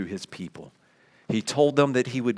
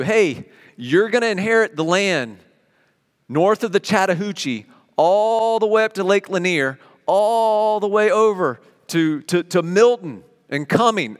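eager announcement; 7 semitones higher, 5 dB louder than usual. Pretend. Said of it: "you start to perk up".

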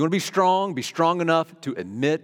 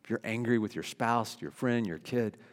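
normal talking voice

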